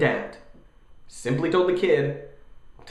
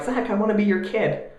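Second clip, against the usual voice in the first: put-on whiny kid voice; 7 semitones higher than usual. Kid voice begging dad.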